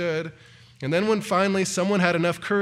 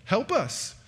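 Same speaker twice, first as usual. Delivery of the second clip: desperate plea; 5 semitones higher than usual. Acted. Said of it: "desperate cries for help".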